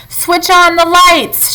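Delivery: high, slow call; shouted command. A narrator's reading. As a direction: sing out the order. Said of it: "shouted Mister Wonka".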